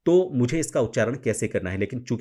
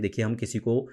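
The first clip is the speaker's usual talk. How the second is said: ordinary talk, nothing marked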